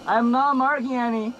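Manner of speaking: loud, high, hyped calling out; excited shouting. Real, not acted.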